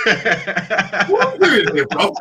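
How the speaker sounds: laughs through the question, slowly; teasing, half laughing. Real, not acted. Teasing a friend's dig.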